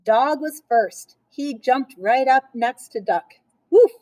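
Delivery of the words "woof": dog woof. A narrator's reading. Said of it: "said Duck".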